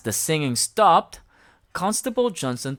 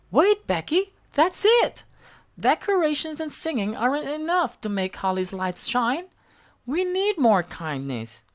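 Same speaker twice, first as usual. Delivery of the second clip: Elmo excited; 11 semitones higher than usual. A narrator's reading. Elmo hitting on the idea, high and excited. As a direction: realise the answer with a jolt.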